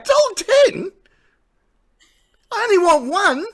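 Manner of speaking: high, loud mock indignation; indignant disbelief. Retold indignant reply to price.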